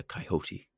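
"coyote" slowly as kai-HOH-tee; soft imitation of "coyote" with a breathed H, kai-hoh-tee; accented coyote. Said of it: "he says it with an accent".

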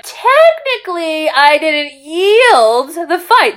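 high, slow, drawn-out mock argument; smug technicality. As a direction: stretch it out like a sly lawyer. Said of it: "he hangs on to a technicality".

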